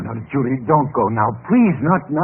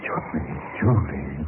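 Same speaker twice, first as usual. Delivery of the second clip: dazed murmur; 11 semitones lower, 8 dB quieter, much slower than usual. Acted. Man overcome after kiss.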